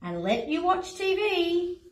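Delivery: ordinary delivery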